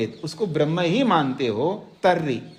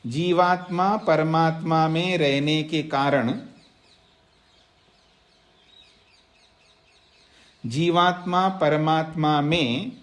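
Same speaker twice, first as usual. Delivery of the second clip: dictating to students. Shown as a demonstration. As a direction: read it out phrase by phrase so they can write. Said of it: "तो सूत्र का अर्थ लिखिएगा". (छात्रों को धीरे-धीरे लिखवाना)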